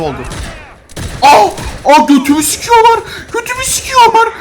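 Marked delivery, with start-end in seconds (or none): 1.2-4.3 high, loud panicked yelling. Real, not acted. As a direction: yell out in sudden panic. Panicked cry while shot in game.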